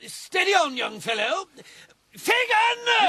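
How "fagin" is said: calling for help. Acted. Frightened captain calls his friend.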